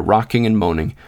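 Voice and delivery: plain reading voice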